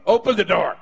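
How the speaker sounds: a playful shout to the person beside you; telling the neighbor. Pretend. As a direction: call it out to your neighbor. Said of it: "Tell your neighbor".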